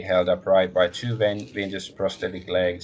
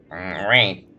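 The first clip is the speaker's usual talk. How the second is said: normal talking voice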